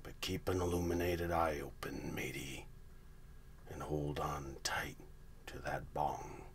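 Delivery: whispered salty pirate drawl; hushed old sea dog, conspiratorial; gruff old fisherman. Acted. Pirate-talk whispered conspiracy intro.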